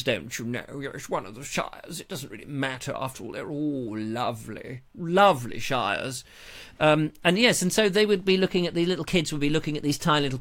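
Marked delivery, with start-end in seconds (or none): 0.0-6.2 softer, hammed-up country gent voice; mock upper-class gent. Joking about the shires, mock upper-class gent voice. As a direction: play a vague old country squire.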